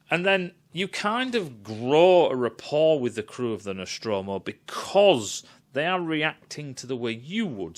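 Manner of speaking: ordinary talk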